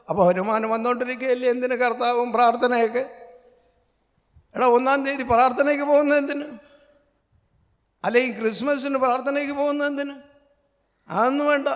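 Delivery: high, fast, loud sneering unbeliever; mocking scoffer. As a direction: ham up the scornful rich man. (പരിഹസിക്കുന്ന അവിശ്വാസിയുടെ ഉയർന്ന, വേഗതയുള്ള ശബ്ദം)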